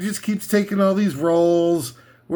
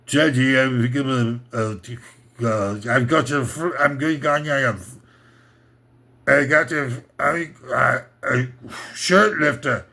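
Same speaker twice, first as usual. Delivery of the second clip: put-on low, halting Austrian boy; mock Austrian accent; 4 semitones lower than usual. Young Arnold stammering to his father, lower mock Austrian accent.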